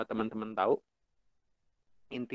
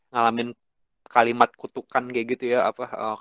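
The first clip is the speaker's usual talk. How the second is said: usual speaking voice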